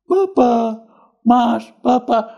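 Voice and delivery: imitating a child's high-pitched crying call; tangisan tiruan. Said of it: "dia nangis". (menirukan adik menangis memanggil-manggil)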